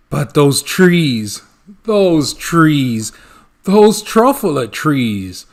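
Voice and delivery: loud, slow wonder at the trees; rapturous. Acted. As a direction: savor each "those", swept away. Once-ler rapturous about trees.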